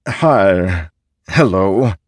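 hesitant, loud male greeting; masculine voice. A narrator's reading. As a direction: play an awkward masculine figure. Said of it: "it said with a masculine voice".

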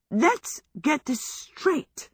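high, slow, halting words; dizzy. A narrator's reading. Sophie, just whirled into a chair, speaks dizzily and slowly. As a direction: piece it together giddily, word by word. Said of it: "Sophie said dizzily".

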